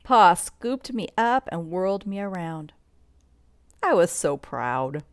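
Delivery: usual speaking voice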